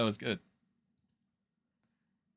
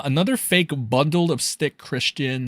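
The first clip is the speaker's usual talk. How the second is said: normal talking voice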